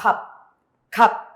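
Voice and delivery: "Cap" as one short syllable stopped on p; pronunciation demo. Shown as a demonstration. Teacher answers how it sounds, pronunciation demo. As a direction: model "Cap" with the final s sound left out. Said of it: "¿Y cómo suena?".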